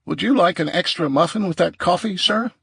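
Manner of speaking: high, posh waiter voice. Acted. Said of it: "Damon said in a posh voice".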